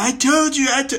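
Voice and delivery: high, loud mock scolding; hammed-up furious I-told-you-so; mad dad. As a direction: imitate an angry father yelling. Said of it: "He was so mad".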